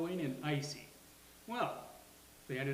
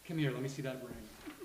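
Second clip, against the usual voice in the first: ordinary delivery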